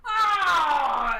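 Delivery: put-on loud, delighted old rancher; excited rancher. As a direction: shout with joy at the owlbears. DM voicing rancher greeting owlbears.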